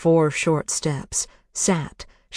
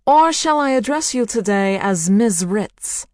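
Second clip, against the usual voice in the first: higher, louder, mocking call over speakers; 7 semitones higher, 5 dB louder than usual. A narrator's reading. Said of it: "Barbra Streisand's voice called out".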